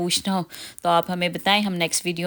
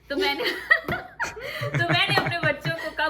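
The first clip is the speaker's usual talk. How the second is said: high voice broken by laughter; giggling through the words; real laughing; 9 semitones higher than usual. Real, not acted. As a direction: burst out laughing, then push on talking. Cracks up while telling anecdote.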